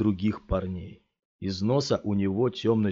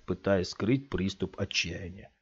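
plain reading voice

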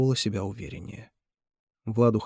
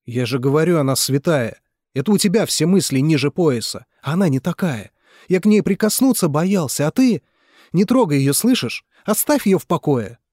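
loud, rising to high yells; furious shouting; 5 semitones higher, 7 dB louder than usual. Acted. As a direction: warn him off with mounting fury. Mitya shouts at Nikolai. (Митя в ярости кричит на Николая)

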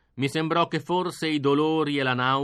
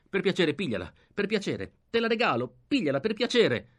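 pleading; 6 semitones higher than usual. Acted. Cowed prisoner begging his attacker.

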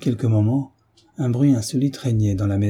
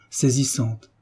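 plain reading voice

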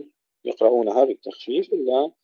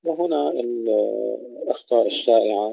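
ordinary talk, nothing marked

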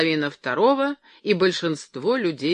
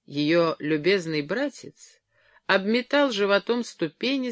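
ordinary delivery, nothing marked

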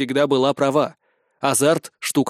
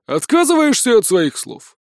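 lightly voiced fat soldier, higher; frowning fat soldier; 8 semitones higher, 4 dB louder than usual. A narrator's reading. Fat soldier challenges the recruit, frowning. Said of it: "нахмурился толстяк".

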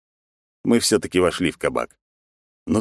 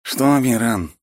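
put-on higher, slurring drunk man; slurred drunk speech; 8 semitones higher, 3 dB louder than usual. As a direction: slur the words like a drunk. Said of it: "заплетающимся языком произнёс он".

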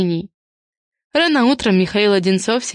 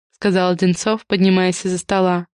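usual speaking voice

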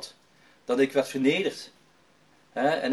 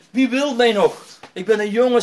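higher, louder desperate plea; imitated sobbing despair; 6 semitones higher, 8 dB louder than usual. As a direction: act out hopeless self-pity at raised volume. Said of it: "hij was echt een snikken".

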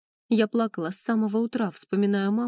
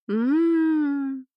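long, higher "mmm" hum; moan of pleasure; 6 semitones higher, 4 dB louder than usual. A narrator's reading. Heroine tastes the delicious lagman, long moan of pleasure. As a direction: savour it with a drawn-out moan. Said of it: "простонала в удовольствии".

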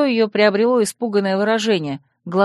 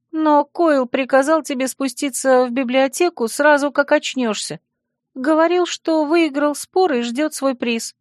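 high-pitched, hesitant friend passing on bad news; apologetic friend; 6 semitones higher than usual. Friend relays a rival's order to the heroine, apologetically. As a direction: voice the friend higher, reluctant to tell.

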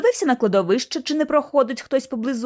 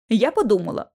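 ordinary delivery

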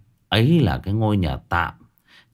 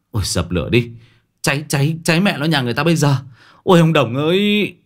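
panicked shouting; 6 semitones higher, 3 dB louder, faster than usual. Acted. Panicked shouting about fire.

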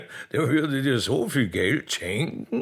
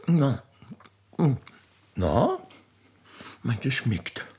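normal talking voice

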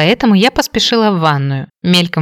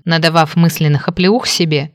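usual speaking voice

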